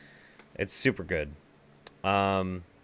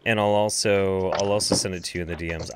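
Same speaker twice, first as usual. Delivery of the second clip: ordinary talk, nothing marked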